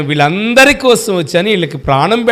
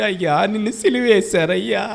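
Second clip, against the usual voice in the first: mock weeping lament; 6 dB quieter than usual. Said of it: "ఏడుస్తా ఉంటావంటే".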